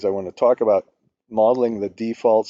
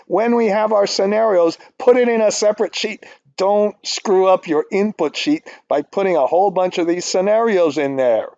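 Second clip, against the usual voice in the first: frustrated; 7 semitones higher than usual. Real, not acted. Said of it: "I went kind of nuts just now".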